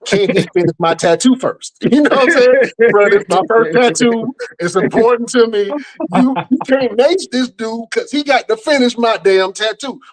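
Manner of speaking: high, pleading protest to the cops; incredulous pleading. Retold or imitated. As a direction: beg them to let him finish, voice climbing high. Pleading with police during raid.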